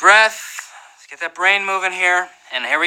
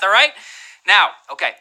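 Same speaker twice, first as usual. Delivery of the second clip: ordinary talk, nothing marked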